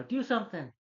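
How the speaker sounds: teacher urges the principal to act; panicked plea. Retold or imitated. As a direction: beg urgently, high and loud.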